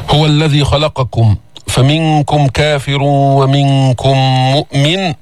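slow measured tajwid chant; Quran recitation. Shown as a demonstration.

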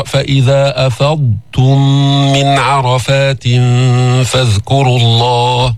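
slow chant of فإذا أفضتم; tajweed chant; Quran recitation. Shown as a demonstration. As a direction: recite slowly and melodiously.

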